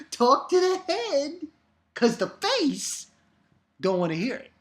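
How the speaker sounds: nail the sassy brush-off this time; sassy catchphrase redo. Pretend. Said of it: "I didn't do it right".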